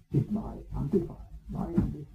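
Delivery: very soft, tender, far-off murmur; soft loving murmur; tender hush. Acted. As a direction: breathe it like a lover's secret. Christ speaks of the bride.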